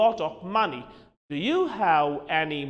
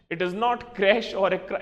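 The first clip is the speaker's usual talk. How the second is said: normal talking voice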